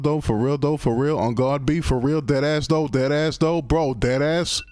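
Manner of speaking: building New York slang chant, rising pitch; hammed-up New York slang riff; mock New York slang. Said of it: "speaking in that accent".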